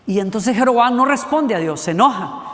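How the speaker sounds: ordinary delivery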